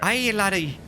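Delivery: high, hammed-up Irish accent; bad Irish accent. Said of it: "Here comes the bad Irish".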